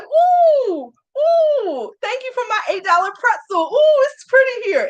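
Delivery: very high, put-on cooing squeal; mock delight. Non-rider admiring the pricey park, mock delighted. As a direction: coo way up high, hamming up the wonder.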